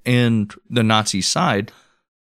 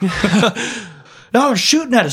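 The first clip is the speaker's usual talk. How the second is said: very high pleading voice; high-pitched fake alarm; mock panic; 12 semitones higher, slower than usual. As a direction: beg for help, hammed up. Plays panicked soldier under fire.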